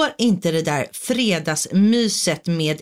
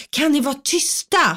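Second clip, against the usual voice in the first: higher-pitched, exasperated plea; exasperated parent; 7 semitones higher than usual. Retold or imitated. Parent to noisy children at half past ten, exasperated.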